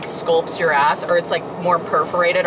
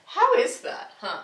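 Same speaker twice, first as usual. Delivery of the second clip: mock scolding dog; 13 semitones higher than usual. Real, not acted.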